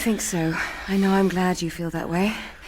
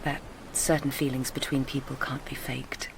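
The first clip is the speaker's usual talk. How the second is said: low hushed whisper, much softer; confiding whisper; 6 semitones lower, 7 dB quieter than usual. Acted. Telling her lover about the old man, hushed whisper. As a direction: whisper the secret.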